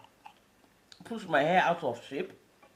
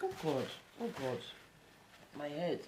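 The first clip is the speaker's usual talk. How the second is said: soft, lower, suffering moan; weak groan; 3 semitones lower, 14 dB quieter than usual. Acted. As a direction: moan it like your head hurts. Sneeze stuck, suffering.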